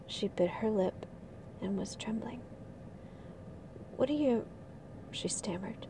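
soft, hushed reading of a novel passage; story reading. Acted. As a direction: read it like a quiet bedtime story. Reads a novel passage aloud.

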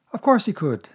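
put-on a small boy catching on; boyish Christopher Robin. A narrator's reading. Christopher Robin voiced higher.

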